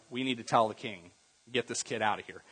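low, subdued fed-up complaint; mildly exasperated aside; tired of it. Acted. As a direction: quietly report the nuisance, a touch weary. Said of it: "somebody was tired of it".

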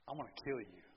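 low and hushed menace; quiet threat. Acted. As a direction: threaten him under your breath. Jezebel threatens Elijah.

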